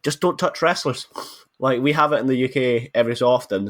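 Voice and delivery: usual speaking voice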